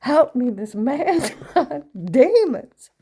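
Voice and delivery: loud, high, slow mock church wail with a laugh; mom shouting for help. Mother crying out about demons, loud slow mock wail. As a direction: ham up a Southern Baptist cry. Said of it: "she would have put her hand up".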